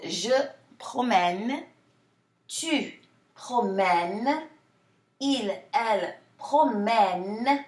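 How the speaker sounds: "promène" with a long, half-smiled eh; slow model forms, stretching the eh in "promène"; elongated open E. Shown as a demonstration. Teacher showing the nuance in pronunciation, elongated eh. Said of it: "the E is more like a eh".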